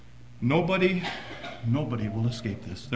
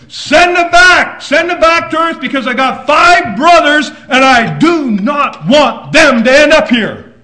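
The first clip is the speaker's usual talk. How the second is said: desperate shout; 20 dB louder than usual. Retold or imitated. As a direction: cry out to save his brothers. Rich man begging, shouted.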